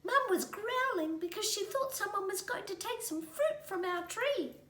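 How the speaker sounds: high little creature voice; baby possum voice. A narrator's reading. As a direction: explain earnestly like a tiny animal.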